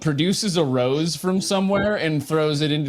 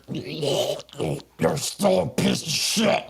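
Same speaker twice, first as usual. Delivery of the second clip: a lightly voiced dying gasp of spite; dying man's last words; much slower than usual. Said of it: "and he's like".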